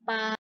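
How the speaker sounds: Pa drawn out on a level pitch; first tone. Shown as a demonstration. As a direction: hold Pa evenly as a first-tone syllable. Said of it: "Nada satu". (Pa panjang dengan nada satu yang datar)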